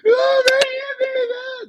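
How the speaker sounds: very high, slow fake crying whine; crying tattle to mom. Kid tattling to mother, very high slow mock cry. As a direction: wail to mom like a little kid. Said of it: "And then she's like".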